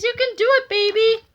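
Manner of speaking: cheering. Acted. Cheering on machine over noise.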